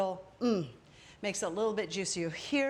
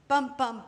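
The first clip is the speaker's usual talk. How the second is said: "bum, bum" hit hard and louder, each falling; punchy count; 2 semitones higher, 5 dB louder than usual. Shown as a demonstration. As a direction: drum out "bum, bum" for the accents. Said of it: "I take a breath in".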